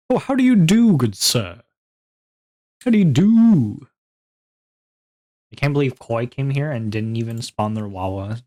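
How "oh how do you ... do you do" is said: higher, louder posh gentleman greeting. Pretend. Hammy greeting to a viewer.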